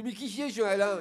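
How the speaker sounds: put-on exasperated boy turning s into sh; speech defect. Little boy asking his classmate about Alain, lisped exasperation. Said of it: "il parle comme ça".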